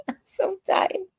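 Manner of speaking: very high, slow words squeezed through laughter; helpless laughter. Real, not acted. Asking the song's name, laughing helplessly. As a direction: laugh so hard the words barely come out. Cracks up at own singing.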